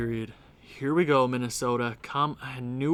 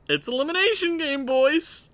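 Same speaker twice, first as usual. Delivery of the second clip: high-pitched hype; 13 semitones higher than usual. Real, not acted. Fan hyping the team.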